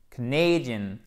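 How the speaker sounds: "Canadian" stressed on "NAY", a full diphthong; second-syllable stress. Shown as a demonstration. Teacher showing students "Canadian" stressed on the second syllable. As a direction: reduce "Ca", lean on "na" as "nay" in "Canadian". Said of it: "Accent tonique sur la deuxième syllabe avec une diphtongue".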